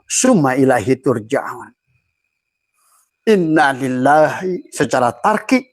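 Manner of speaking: usual speaking voice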